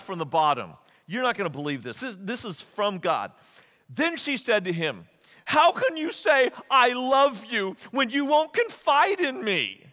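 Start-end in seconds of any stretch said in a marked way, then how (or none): 5.5-9.9 high, loud wounded complaint; hammed-up nagging girlfriend, drawn out at the end; mock reproach. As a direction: read it as an indignant guilt trip. Reads Delilah's reproach in acted voice.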